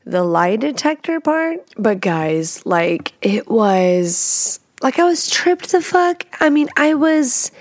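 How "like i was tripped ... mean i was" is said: freaked out. Real, not acted. Describing being freaked out.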